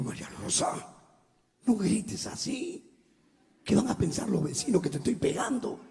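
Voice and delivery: hushing whisper. Retold or imitated. Husband whispering to his crying wife at night. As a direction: shush her so the neighbours won't hear.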